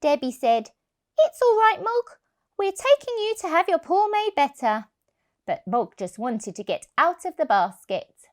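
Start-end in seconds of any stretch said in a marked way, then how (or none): 1.2-4.9 put-on higher, soothing little girl; child's voice. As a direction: reassure the cat like a child. Child comforts cat.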